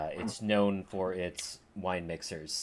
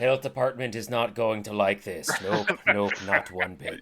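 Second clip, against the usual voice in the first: grumbling dwarf barkeep; 3 semitones higher, 12 dB louder than usual. Acted. Dwarven barkeep grumbles at the healers about the health department. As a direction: mutter disapproval like a stubborn barkeep. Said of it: "He's like".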